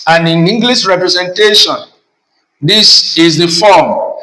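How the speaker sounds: ordinary talk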